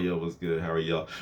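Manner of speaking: low, soft casual hello; subdued greeting. Retold or imitated. Him greeting the girls while drying his face, quiet and low. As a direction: keep it low, hiding recent tears. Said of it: "still kinda drying my face and stuff".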